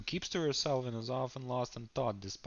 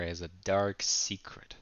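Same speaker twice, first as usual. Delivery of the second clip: ordinary talk, nothing marked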